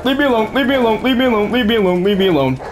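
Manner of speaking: high frantic pleading; panicking gamer. Real, not acted. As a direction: beg them to back off. Chased by enemies, panicking.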